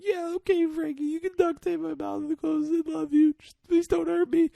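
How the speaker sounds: squeaky-high imitation of a scared victim; frightened pleading. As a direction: plead meekly to be spared. Retells victim pleading not to be hurt.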